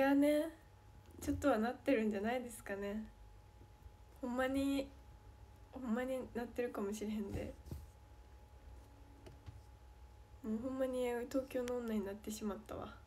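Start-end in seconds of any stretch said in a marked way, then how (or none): 4.2-12.9 easy Kansai-ben; Kansai dialect. Real, not acted. Teasing viewers that she became a Tokyo girl, in Kansai dialect. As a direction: slip into her home Kansai dialect. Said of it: "関西弁で言ってる".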